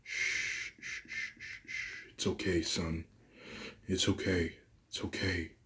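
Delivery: hushed whisper then soft murmur; tender shushing to comfort a crying son; soothing hush. A narrator's reading. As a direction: shush him gently like a scared child. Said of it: "she speaks to him".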